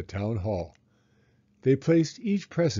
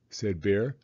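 plain reading voice

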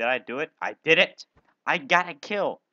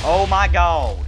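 louder, slow drawn-out exclamation; loud shocked cry; 6 semitones higher, 12 dB louder, slower than usual. Real, not acted. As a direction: stretch it out in loud amazement. Surprised by weapon effect.

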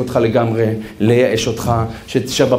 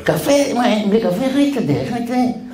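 mock whine; 9 semitones higher than usual. Whining about life without coffee.

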